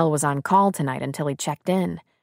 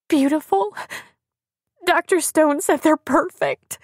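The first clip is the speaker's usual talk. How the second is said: high-pitched tearful voice; sobbing; 11 semitones higher, 3 dB louder than usual. A narrator's reading. Crying pregnant woman reports on ultrasound, sobbing. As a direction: wail it through acted sobs. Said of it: "her words caught in a sob".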